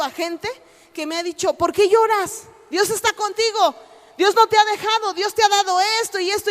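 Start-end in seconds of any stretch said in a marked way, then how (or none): 1.5-6.5 raised, higher, insistent encouragement; firm comforting. Retold or imitated. Friends urging the crying preacher to stop weeping, raised and insistent. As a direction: lift her up with urgent reassurance.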